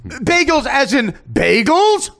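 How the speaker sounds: high, loud, drawn-out question; mock sarcasm. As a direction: stretch the last BAGELS slowly upward in sarcastic disbelief. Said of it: "go up at the end of a sentence".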